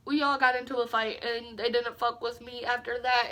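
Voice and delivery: ordinary talk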